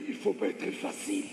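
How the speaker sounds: normal talking voice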